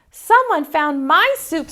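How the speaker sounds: high squeaky little bear. Acted. Baby Bear finding her empty soup bowl, high squeak. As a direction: sputter it in a small high squeak. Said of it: "sputtered Baby Bear in her high, squeaky voice".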